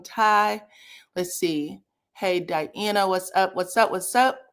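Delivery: usual speaking voice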